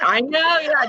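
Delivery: high, loud burst through laughter; laughing agreement. Real, not acted. Host agrees with guest's joke, laughing out loud. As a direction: agree heartily while laughing.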